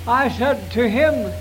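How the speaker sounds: ordinary delivery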